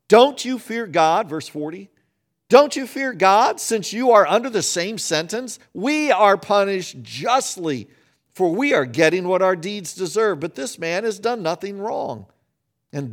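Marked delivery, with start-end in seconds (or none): 0.1-1.3 mild rebuke. Retold or imitated. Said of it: "he turns to his friend and he says". 2.5-12.3 mild rebuke. Retold or imitated. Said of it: "he turns to his friend and he says".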